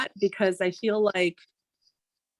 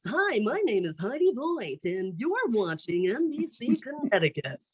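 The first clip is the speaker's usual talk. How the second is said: polished, higher TV-anchor read, each word presented; stiff news anchor voice; 3 semitones higher, 4 dB quieter than usual. Retold or imitated. Mocking how anchors talk, in a stiff newscaster voice. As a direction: put on the stock newscaster delivery from cartoons. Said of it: "then they talk like this".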